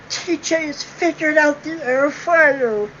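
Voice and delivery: sarcastic slow proclamation; mock announcement. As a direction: announce it like front-page news, slowly.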